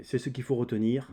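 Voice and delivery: ordinary talk, nothing marked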